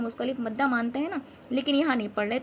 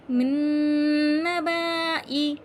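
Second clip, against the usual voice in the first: long held "मिन", louder chant; recitation demo; 3 semitones higher, 7 dB louder, much slower than usual. Shown as a demonstration. Teacher reading the word without the alif, slow chanting. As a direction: recite slowly in drawn-out syllables. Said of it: "अलग से इस अलिफ को नहीं पढ़ना".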